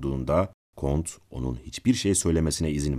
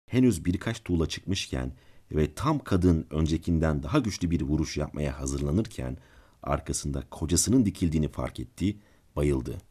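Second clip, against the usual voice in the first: plain reading voice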